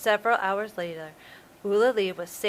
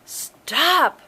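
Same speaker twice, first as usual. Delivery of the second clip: loud, higher commanding shout; yelled; 7 semitones higher, 8 dB louder than usual. Acted. The cane worker yelling at the shark as he bursts in. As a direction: shout to halt the attack. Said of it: "yelled the man".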